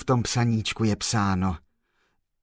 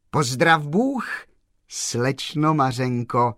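slow, raised greeting called through a window; slow, louder, higher hail; calling out; 4 semitones higher, 5 dB louder, slower than usual. A narrator's reading. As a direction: call to her across the yard. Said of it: "zavolal pan Kolbaba".

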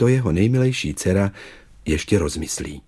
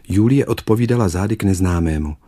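plain reading voice

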